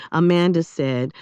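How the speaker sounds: plain reading voice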